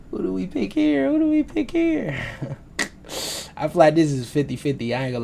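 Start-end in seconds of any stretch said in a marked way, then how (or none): 0.1-2.2 very high put-on voice; high-pitched mock deliberation; playful falsetto question. Playfully puzzles over next pick.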